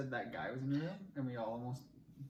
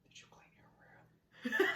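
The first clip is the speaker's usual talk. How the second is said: slow, creepy parental whisper; mock-sinister slow whisper; slower than usual. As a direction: whisper it slowly to unnerve a child. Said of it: "like, just creepily".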